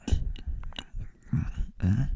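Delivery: imitated mumbling through biscuits; mock grunts with a full mouth; mouth-full mumble. Imitating the teacher eating biscuits, mouth-full mumble. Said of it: "he's got these in his mouth".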